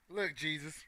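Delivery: hushed whisper to Jesus; whispered plea; whispered aside. Real, not acted. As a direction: mutter a quick prayer.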